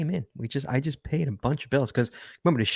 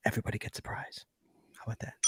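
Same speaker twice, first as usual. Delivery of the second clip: whisper; 2 semitones lower, 13 dB quieter, faster than usual. Acted. Host teases prize.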